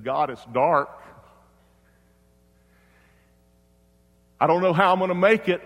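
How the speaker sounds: retold widower crying out in prayer; anguished prayer. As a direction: build from a plea to a loud, high outcry. Grieving father cries out to God.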